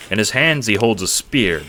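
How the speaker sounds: ordinary delivery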